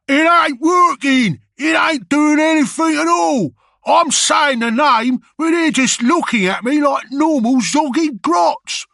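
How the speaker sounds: a frantic orc yelling at the crowd; hollering orc. Acted. Orc hollering that the ritual fails, bellowing. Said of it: "an orc started hollering".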